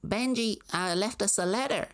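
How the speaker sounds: high, sugary small-town mother voice; mock Maine mom. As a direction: gush like a proud mom in Maine. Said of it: "So it'd be like".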